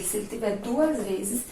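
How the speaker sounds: normal talking voice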